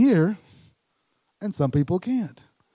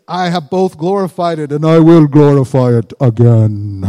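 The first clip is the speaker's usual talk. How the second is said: much louder, rattling divine boom; booming voice from heaven; 3 semitones lower, 12 dB louder than usual. Acted. Preacher imitates God's voice booming from heaven, loud and rattling. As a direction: boom it down from the sky like God. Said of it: "this voice from heaven booms down".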